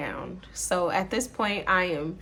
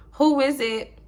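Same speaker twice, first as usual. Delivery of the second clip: higher, louder mock-innocent call; 7 semitones higher, 6 dB louder than usual. Boyfriend playing dumb to her banging, mock innocent and higher. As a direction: play dumb behind the door, pitched up. Boyfriend behind the locked door.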